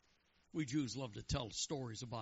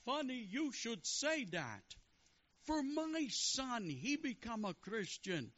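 high, slow comic rabbi; 10 semitones higher, slower than usual. Acted. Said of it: "the rabbi looks around and he says".

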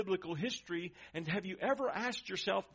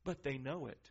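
usual speaking voice

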